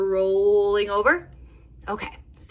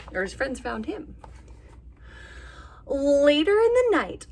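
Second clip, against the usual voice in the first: lively storybook read with voiced animals; reading to children; 5 semitones higher, 3 dB louder, slower than usual. A narrator's reading. Teacher reading a bear story to little kids, animated. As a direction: tell it like a bedtime picture book. Reads picture book aloud.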